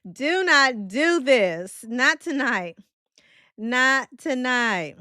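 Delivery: loud, slow plea; drawn-out loud warning; pleading. Real, not acted. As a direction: beg them loud and slow to stop. Begging chat not to start.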